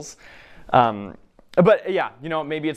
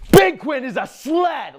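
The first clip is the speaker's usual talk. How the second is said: full-out high, drawn-out shout; yelling child; 10 dB louder, slower than usual. Acted. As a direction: bellow it slowly like a kid rallying every child around. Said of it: "and yells".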